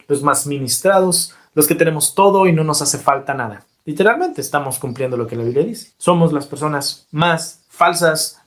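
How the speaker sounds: ordinary talk, nothing marked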